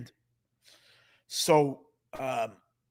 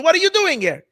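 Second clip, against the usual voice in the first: much higher, louder astonished question; surprised question; 11 semitones higher, 8 dB louder than usual. Retold or imitated. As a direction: ask it amazed. Rabbi surprised seeing his friend.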